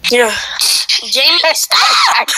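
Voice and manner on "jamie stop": drawn-out shouted protest; shouted order. Real, not acted. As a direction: shout for them to stop, dragging it out. Telling Jamie off in horseplay.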